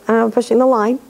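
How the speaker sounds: ordinary talk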